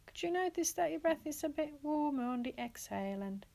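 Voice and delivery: ordinary delivery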